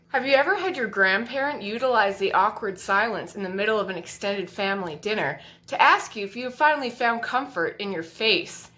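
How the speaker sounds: usual speaking voice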